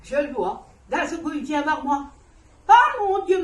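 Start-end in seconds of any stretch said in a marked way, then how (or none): 2.7-3.4 sudden higher, louder gasp of alarm; startled cry. Retold or imitated. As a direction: cry out in sudden fright at what you see. Retold shock seeing her son. (cri de surprise, plus aigu et plus fort)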